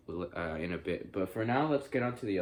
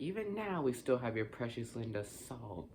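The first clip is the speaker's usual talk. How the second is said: imitated possessed Linda; 3 semitones higher, 7 dB quieter than usual. Imitating possessed Linda taunting Ash, soft mock-creepy voice. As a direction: imitate a demon's quiet taunt, pitch sliding down. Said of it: "she's like".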